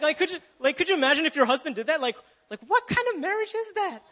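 laughing, scandalised disbelief; incredulous. Real, not acted. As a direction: ask it in outraged disbelief. Incredulous at imagined husband.

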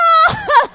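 high, loud hearty chuckle; jolly laugh. Retold or imitated. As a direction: laugh like a delighted dad. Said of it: "he sounded so jolly".

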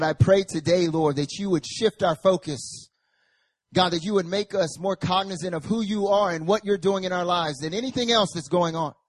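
normal talking voice

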